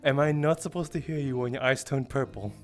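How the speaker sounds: ordinary talk, nothing marked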